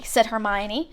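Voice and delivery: ordinary delivery, nothing marked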